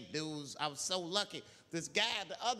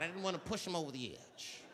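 ordinary talk, nothing marked

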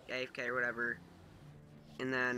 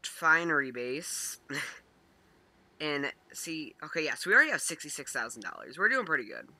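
loud excited; 3 semitones higher, 10 dB louder than usual. Acted. Sudden loud hype while playing.

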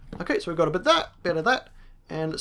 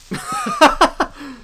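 very high, loud cackle; laugh; 5 dB louder than usual. Real, not acted. Player gleeful after killing mobs, high cackle.

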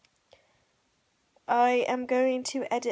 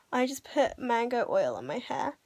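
usual speaking voice

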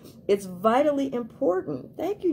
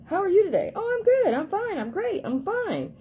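high, bright hammed-up I'm fine; high mock chirpy fine; fake cheerful fine; 10 semitones higher than usual. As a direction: play the automatic brush-off, high. Acted fake fine reply.

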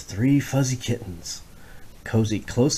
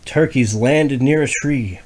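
plain reading voice

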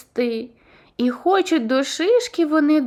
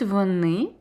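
ordinary delivery

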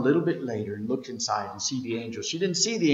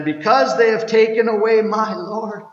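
high, loud grieving cry; anguished cry; 7 semitones higher, 7 dB louder than usual. Acted. Mary tells the angels her Lord is taken, anguished cry.

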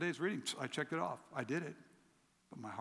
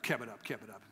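ordinary talk

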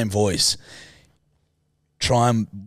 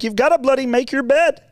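high, loud mock Peterson rant; high-pitched lecturing impression; Jordan Peterson impression; 13 semitones higher, 3 dB louder than usual. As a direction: ham up his bloody lecturing voice. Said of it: "he talks like this".

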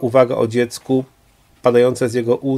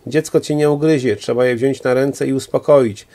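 ordinary delivery

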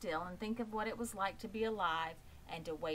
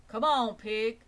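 louder gruff farmhand call; 5 semitones higher, 12 dB louder than usual. Acted. Lurvy the farmhand calls the pig to eat, loud.

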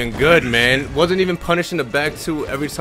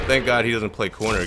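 ordinary talk

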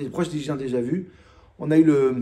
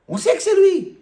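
exasperated; 6 dB louder than usual. Shown as a demonstration. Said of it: "on se calme un peu".

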